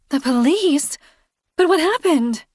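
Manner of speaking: high, bright alarmed neighbor; Kirsten alarmed. A narrator's reading. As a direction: voice her high and startled.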